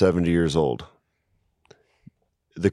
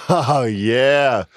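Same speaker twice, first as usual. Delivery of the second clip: usual speaking voice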